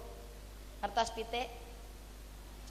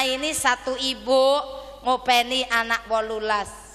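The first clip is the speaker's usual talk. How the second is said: ordinary talk, nothing marked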